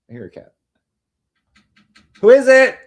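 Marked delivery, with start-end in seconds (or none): 2.2-2.8 loud, high call to the door. Real, not acted. Calling out loud to someone knocking at the door. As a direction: shout it through the house to the door.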